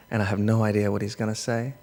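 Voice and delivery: normal talking voice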